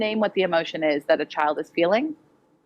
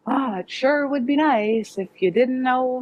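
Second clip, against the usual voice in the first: high, slow, sugary hinting; hint sweetly instead of asking; vague wishy-washy; 4 semitones higher, slower than usual. Shown as a demonstration. Teacher mocking indirect requests, high, slow and sugary. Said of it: "You don't wanna say".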